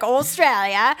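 cartoonish, loud Australian accent; terrible Australian accent. Imitating own slip while naming a donor, bad Australian accent. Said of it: "terrible accent. It's like a Finding Nemo".

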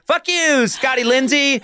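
high mock yell at a neighbour by name; playful high-pitched curse at someone you know; mock road rage. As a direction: curse him out, half laughing. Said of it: "it would be like".

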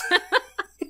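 high squealing burst of laughter. Real, not acted. Friends laughing at a movie scene, high squealing laugh.

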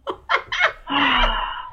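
long, falling vocal sigh; exasperated sigh. Real, not acted. Caller worn out after ranting, exasperated sigh. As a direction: let out a drawn-out groaning sigh.

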